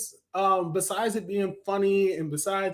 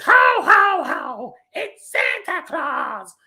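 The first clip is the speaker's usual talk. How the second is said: loud, high-pitched shouted Santa impression; Gilbert Gottfried's Santa; 11 semitones higher, 6 dB louder, much slower than usual. Reviewer mimicking the cartoon's Santa Claus, high shouted impression. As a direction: belt it out high, stretching the last words. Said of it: "Santa Claus is voiced by Gilbert Gottfried".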